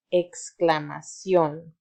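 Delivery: exclamación said slowly and clearly; slow model word. Shown as a demonstration. Teacher models exclamación for the class to repeat, slow and clear. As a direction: model exclamación for the kids to echo. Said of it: "Repite conmigo".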